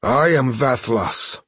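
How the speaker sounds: slow rasping proud voice. Acted. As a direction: rasp it out slowly. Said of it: "he rasped".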